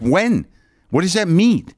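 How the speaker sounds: fed-up demand at the clerk; irritated customer pressing the clerk; exasperated demand. Retold or imitated. Quarrel with rental clerk.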